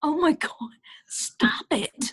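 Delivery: hushed embarrassed whisper; mortified whisper to the child; whispered plea. Retold or imitated. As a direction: whisper it through clenched embarrassment. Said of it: "And I go".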